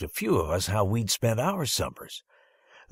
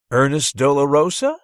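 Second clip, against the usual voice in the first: loud, higher questioning call; repeated call; 6 semitones higher, 9 dB louder than usual. Acted. Teacher repeating an unanswered name, loud and questioning. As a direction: call the name again across the room. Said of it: "Miss Paula repeated".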